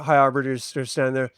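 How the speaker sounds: ordinary talk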